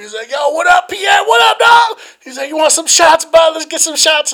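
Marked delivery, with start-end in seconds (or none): 0.3-2.0 put-on very high, loud drunk hello; imitated exuberant greeting. Acting drunk friend's greeting. 2.5-4.3 high, loud, eager invitation; rally your buddy for drinks; imitated drunken enthusiasm. Acting drunk friend offering shots.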